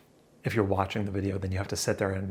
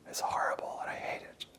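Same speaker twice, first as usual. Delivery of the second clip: whisper; 10 dB quieter than usual. Real, not acted.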